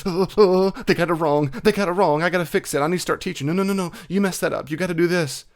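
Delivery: high, loud, frantic fretting; mock panic. The host pretending to panic over wrong answers in class, high and loud. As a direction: ham up a flustered parent rushing to correct. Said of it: "Does my heart start racing?".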